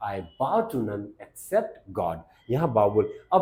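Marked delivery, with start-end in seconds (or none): 0.1-2.2 each word slow and spaced, bow said as "bau"; slow dictation of the line; slow careful demo. Shown as a demonstration. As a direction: teach it word by word, slowly. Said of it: "क्या बोलेंगे?".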